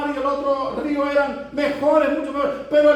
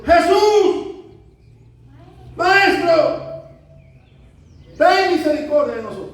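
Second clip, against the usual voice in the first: loud, high pleading shout; crying out; 5 semitones higher, 5 dB louder than usual. Acted. Lepers begging Jesus from a distance, crying out loudly. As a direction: call from far off for mercy. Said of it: "alzaron la voz diciendo".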